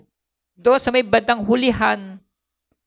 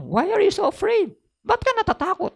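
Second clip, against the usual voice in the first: much higher, lightly put-on voice; preacher acting Jesus asking the disciples, voice raised high; Jesus' voice; 10 semitones higher than usual. Preacher voices Jesus' question again.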